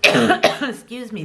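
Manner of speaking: dry cough. Real, not acted.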